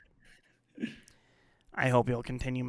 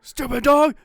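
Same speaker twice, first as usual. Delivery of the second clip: put-on much higher and louder grumpy old man; 13 semitones higher, 13 dB louder, slower than usual. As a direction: squawk it like a cranky cartoon geezer. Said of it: "Like, higher".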